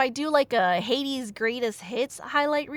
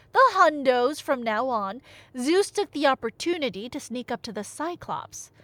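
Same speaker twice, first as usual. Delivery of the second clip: ordinary delivery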